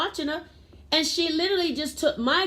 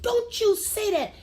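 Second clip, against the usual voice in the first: mother scolding her son at the park, stern; 7 semitones higher than usual. Retold or imitated. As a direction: scold the child firmly. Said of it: "And I said".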